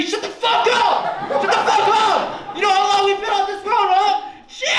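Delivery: fed-up dad screaming from the driver's seat; furious dad. Pretend. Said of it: "realistic version".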